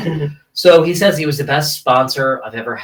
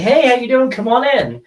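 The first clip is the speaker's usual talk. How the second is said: high, upbeat host's welcome; imitated friendly greeting; 12 semitones higher, 3 dB louder than usual. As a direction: greet a guest at the door in a much higher voice. Greeting guest at party door.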